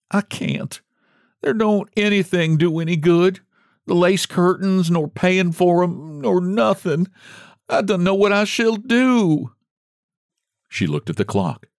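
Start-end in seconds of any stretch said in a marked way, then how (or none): 0.1-9.5 high, tearful despair, lightly played; a touch of weeping in a higher voice; crying. Margaret weeps over her Bible, understated tearful voice. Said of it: "she began to cry".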